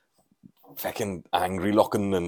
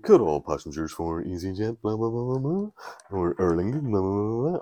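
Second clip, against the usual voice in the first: imitated gate call with swooping blahs; airport tannoy announcement. Announcer calling passengers in London, mock tannoy drone. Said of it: "you hear a, a gate being called".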